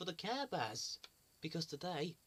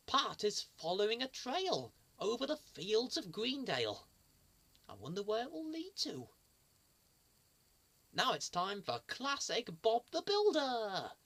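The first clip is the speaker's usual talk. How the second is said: usual speaking voice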